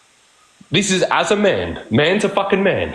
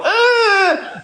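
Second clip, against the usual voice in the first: a sudden, much higher, hammed-up cry of offence; mock offended shriek. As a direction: shriek like a woman taking offence, exaggerated. Offended woman's reaction acted out.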